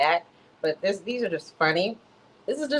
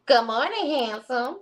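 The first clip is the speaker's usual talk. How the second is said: high-pitched morning greeting to a lover; hammed-up sweet hello; 8 semitones higher, 3 dB louder, slower than usual. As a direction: greet your sweetheart in her put-on voice. Acting out Sonia's morning text.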